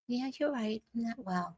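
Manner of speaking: soft, resigned giving in; meek mock agreement. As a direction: give in quietly, as if beaten.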